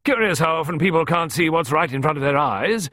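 high, loud, jovial countrywoman; hearty. A narrator's reading. Visitor amused as she leaves, hearty. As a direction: boom it out with amusement. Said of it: "She chortled heartily".

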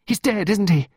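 put-on high, loud, anxious child; frightened high-pitched questioning; distressed child. A narrator's reading. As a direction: ask in rising dread, dragging the last words. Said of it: "Pippa asked".

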